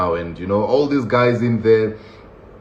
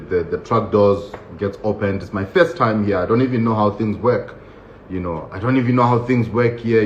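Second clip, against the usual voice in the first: usual speaking voice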